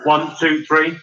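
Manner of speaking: usual speaking voice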